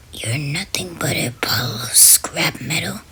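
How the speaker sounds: low, whispered creature voice; groggy creature voice. Acted. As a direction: rasp it like a shadow monster. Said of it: "spoke in a groggy voice".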